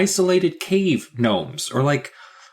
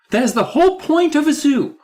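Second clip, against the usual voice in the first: exasperated; 13 semitones higher, 5 dB louder than usual. Real, not acted. Exasperated rant about gnomes.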